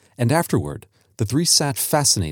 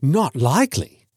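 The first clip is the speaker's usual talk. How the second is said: drawn-out mock horror, pitch raised; pretended horror; 4 semitones higher than usual. Son teasing his father, mock horror. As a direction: feign horror, slowly. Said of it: "said Herbert with pretended horror".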